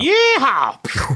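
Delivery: high-pitched yeehaw holler; mock cowboy whoop. Waiter celebrating before firing a gun, high cowboy whoop.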